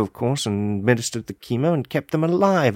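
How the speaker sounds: usual speaking voice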